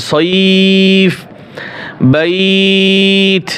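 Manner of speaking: সইফ with a long ই glide, not a short সব; stretched vowel. Shown as a demonstration. Teacher warning students not to clip সইফ. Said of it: "যেন সব যেন না বলেন".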